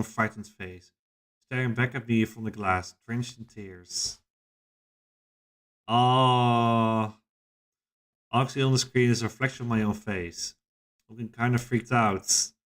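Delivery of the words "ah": loud, drawn-out cry; long cry. Acted. Narrator sees own tearful reflection, drawn-out cry. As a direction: let out one long, held wail.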